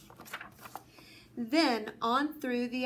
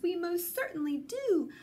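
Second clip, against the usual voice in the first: piping voice; 6 semitones higher than usual. A narrator's reading. Who mayor answers Horton, high piping voice. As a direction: pipe it up like a tiny eager person. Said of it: "piped the voice".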